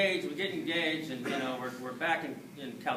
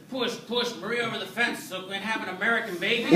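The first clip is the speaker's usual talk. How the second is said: mock birth coach; 6 semitones higher, 4 dB louder than usual. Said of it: "what's the Mexican birth sound like".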